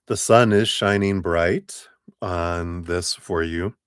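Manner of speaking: ordinary delivery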